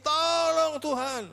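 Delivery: high, loud, slow begging; desperate plea. Retold or imitated. Preacher showing how the mother begged relentlessly, high and loud. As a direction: beg with everything you have.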